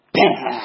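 loud explosive burst of anger; angry outburst. Retold or imitated. Driver finally snapping at passengers, loud explosive outburst. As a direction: blow up with a loud bang of a shout. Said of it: "ベーンって怒った".

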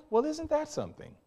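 high, hushed marvelling; soft wonder. Retold or imitated. Student marvels at her professor, soft and high. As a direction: breathe it out, amazed.

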